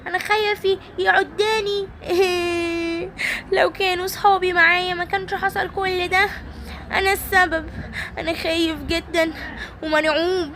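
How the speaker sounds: high, whimpering, hammed-up sobs; mock crying toy. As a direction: wail about being lost, high. Simba alone, fake crying. (بكاء تمثيلي بصوت عالٍ)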